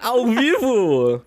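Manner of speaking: drawn-out, high-pitched mock disbelief; mock shock. Podcast host teasing co-host over a risky joke, mock shock. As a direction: stretch it out high, playing scandalized.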